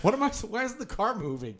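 put-on confused old woman. Voicing confused elderly driver.

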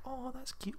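soft, high murmured aside; little quiet coo. Retold or imitated. Girl murmuring to her boyfriend, little quiet coo. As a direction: keep it small, high and discreet. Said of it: "Not, like, a little".

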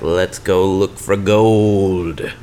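slow, stretched-out words; drawn-out proposal. Acted. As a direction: draw out the kids' big idea. Kids propose a treasure hunt.

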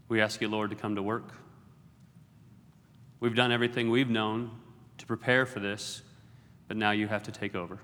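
hushed, reverent prayer to God; pastor's prayer. Acted. Pastor opens the sermon praying before the congregation, hushed and reverent.

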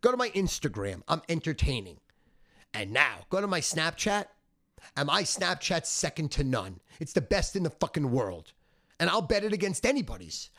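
usual speaking voice

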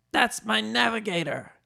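high, proud put-on voice; Danny DeVito. A narrator's reading.

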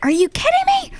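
high, disbelieving squeal; incredulous. Retold or imitated. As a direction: act stunned and outraged. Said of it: "And we're like".

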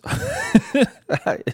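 squealing high laugh. Real, not acted. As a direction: let out a high burst of laughter. Laughs at own joke.

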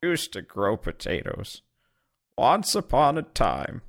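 slow, soft, lightly voiced old man; a hint of a tired old farmer reminiscing. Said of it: "let's hear an old man voice".

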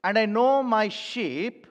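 each word of "And I know my sheep" drawn out slowly; slow reading. Shown as a demonstration. Pastor slowing down so the congregation keeps up, slow and deliberate. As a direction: read it slowly so the church can follow. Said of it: "I go slowly here".

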